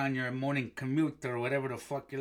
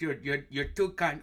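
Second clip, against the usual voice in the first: ordinary delivery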